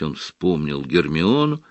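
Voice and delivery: plain reading voice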